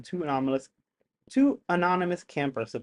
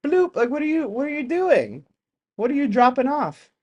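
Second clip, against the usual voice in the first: higher, playful mock bafflement; lightly voiced puzzled question; mock alien question; 9 semitones higher, 3 dB louder than usual. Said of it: "Like, they'll just".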